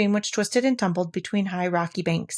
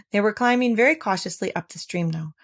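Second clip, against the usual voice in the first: ordinary delivery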